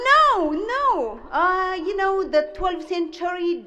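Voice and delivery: mother bluffing about Dante; eager mother. Retold or imitated. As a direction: jump in to show you know.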